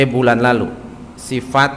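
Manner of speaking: ordinary talk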